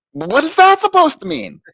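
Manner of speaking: very high-pitched disbelief; incredulous retort. Retold or imitated. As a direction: squeak it out in exaggerated bafflement. Acting out his escalating disbelief.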